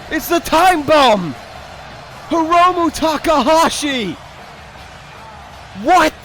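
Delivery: disbelieving yell of real surprise; stunned surprise. Real, not acted. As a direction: blurt it out as the surprise entrant hits the stage. Surprise entrant shocks the commentator.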